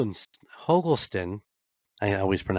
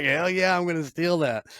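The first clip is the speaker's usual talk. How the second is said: excited agreement; 8 semitones higher than usual. Retold or imitated. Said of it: "And I'm like".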